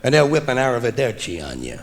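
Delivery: normal talking voice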